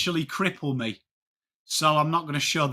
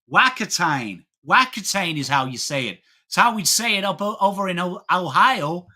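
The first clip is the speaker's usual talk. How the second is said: imitating a know-it-all American tourist; American accent, laying down how it is said; mock American. American from Ohio correcting the local woman, mock American accent. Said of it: "there was an American there".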